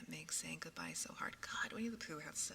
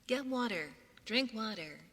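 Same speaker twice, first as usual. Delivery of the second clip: louder, higher, robotic command; accidental robot voice, pitched up; 4 semitones higher, 7 dB louder than usual. Real, not acted. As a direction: order it like a robot. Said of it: "That sounds like a robot".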